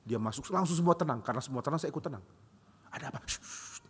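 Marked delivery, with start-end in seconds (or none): 2.9-3.8 whispered, barely audible; hushed whisper. Retold or imitated. Pupil whispering to classmates as the teacher walks in. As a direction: hush your classmates under your breath.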